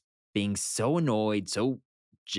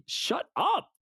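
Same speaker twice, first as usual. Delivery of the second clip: mock exasperated. The Pyms retold as exasperated.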